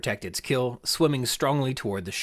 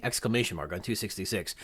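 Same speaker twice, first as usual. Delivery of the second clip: ordinary talk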